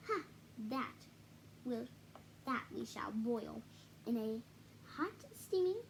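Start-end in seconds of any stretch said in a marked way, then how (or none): none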